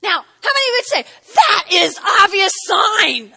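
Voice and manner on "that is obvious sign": high, loud, slow cry of awe; mock amazed cry. Preacher mimicking an awed onlooker, mock amazed cry. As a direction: cry out as if stunned by the miracle. Voicing the obvious sign.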